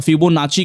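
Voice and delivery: फिबोनाची with a "ch" as in chin; "ch" pronunciation. Shown as a demonstration. Teacher listing how some people say it, फिबोनाची with "ch". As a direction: say फिबोनाची, ending in "-chee". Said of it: "अलग-अलग pronunciation करते हैं".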